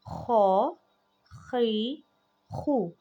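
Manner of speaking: खौ खी खू with a harsh throat scrape; rasped kh with vowels. Shown as a demonstration. Teacher drilling kha with vowels, rasping throat. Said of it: "जो खौ है उसमें खराश आती है".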